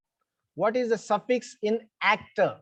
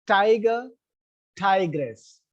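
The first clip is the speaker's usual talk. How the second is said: "Tiger, tigress" both stressed on the first syllable; stress stays put; slower than usual. Shown as a demonstration. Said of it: "still the stress is on".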